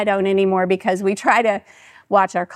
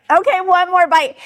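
much higher, escalating self-bargaining; giving in eagerly; 8 semitones higher than usual. Retold or imitated. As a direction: act out caving in with rising excitement. Repeats giving in, more eagerly.

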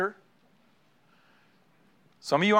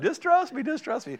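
high, mocking sing-song; mock imitation; 11 semitones higher than usual. Friend mocking the husband's promise, high and teasing. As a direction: tease in a high voice.

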